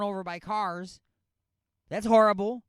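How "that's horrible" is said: loud disgust. Real, not acted. Reacting to imagined crash.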